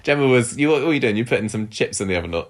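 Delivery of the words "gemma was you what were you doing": ordinary delivery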